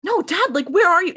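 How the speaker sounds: a younger brother begging for his dad; upset plea. Retold or imitated. Sam begging his dad on the phone, high and upset. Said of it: "so sad to me".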